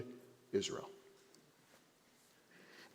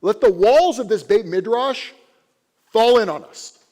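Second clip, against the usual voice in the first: louder, higher frustrated command; furious rabbi; 8 semitones higher, 10 dB louder than usual. Acted. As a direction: thunder at the students in rising anger. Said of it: "He's getting very frustrated".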